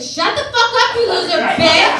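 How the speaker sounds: very high, loud scream; explode, stretching out the final insult; acted furious outburst. Mother losing it at daughter in waiting room, screaming. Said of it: "I lost my shit".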